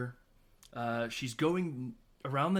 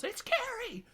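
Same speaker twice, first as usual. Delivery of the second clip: very high-pitched imitation yell; mock yell; slower than usual. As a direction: squeal it like a retold outburst. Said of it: "which Carrie yells".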